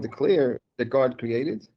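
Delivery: slow and carefully enunciated; over enunciating. Shown as a demonstration. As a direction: spell out each word slowly so it's clear. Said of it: "let me say it again slowly".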